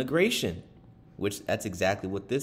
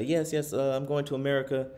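higher, lightly voiced eager yes-man; eager migrant answering; 7 semitones higher than usual. Migrant answering a documentary interviewer, higher, eager. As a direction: imitate a migrant keen to say yes. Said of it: "certain people that would say".

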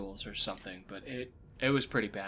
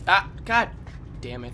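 frustrated curse; 8 semitones higher, 11 dB louder than usual. Real, not acted. Zapped again in game.

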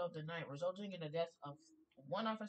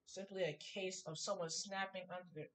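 usual speaking voice

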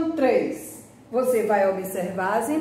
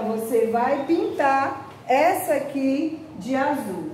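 ordinary talk

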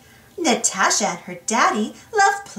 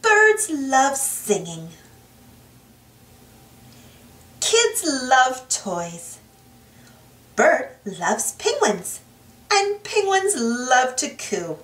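put-on reading to children. A narrator's reading. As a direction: read it like a bedtime storybook for little kids. Reading picture book to children.

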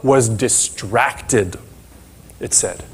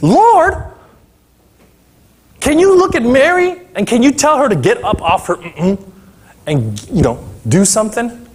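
frustrated sister demanding help; exasperated Martha; 7 semitones higher than usual. Acted. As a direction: plead with the Lord in frazzled frustration. Martha complaining to Jesus about Mary.

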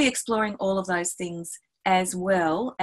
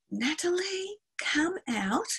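soft, high, inviting voice; hushed, lilting invitation to a young pupil; coaxing teacher; 4 semitones higher, 5 dB quieter than usual. Acted. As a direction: gently draw a shy child into a mystery. Teacher coaxing a child forward.

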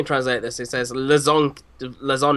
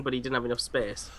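normal talking voice

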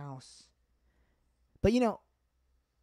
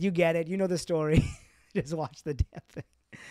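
louder, impatient imitated dad; dad's voice; 4 semitones higher, 5 dB louder than usual. Dad telling son to just watch, mock impatient. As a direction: wave the kid off. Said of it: "He's like".

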